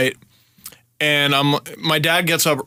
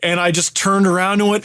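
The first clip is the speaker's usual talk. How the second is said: ordinary delivery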